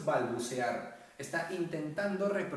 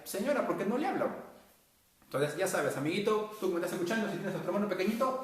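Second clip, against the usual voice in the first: ordinary talk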